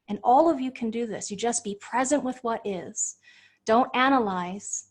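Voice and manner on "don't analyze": ordinary delivery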